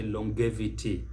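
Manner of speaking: longevity with a hard g, long-GEV-ity; wrongly harden the g in longevity; mispronunciation. Shown as a demonstration. Teacher shows what not to say, mispronounced longevity. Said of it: "don't say".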